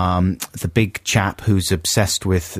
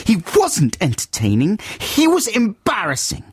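high-pitched mock outburst; exasperated, raised-pitch mock shout; 11 semitones higher than usual. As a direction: read it like an indignant letter in capitals. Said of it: "Brackets, shouting at the end".

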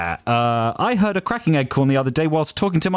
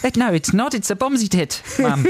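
put-on high-pitched comic Australian accent; 8 semitones higher than usual. Correcting co-host on the phrase in an Australian accent. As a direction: put on a jokey Australian twang. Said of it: "read that in an Australian accent".

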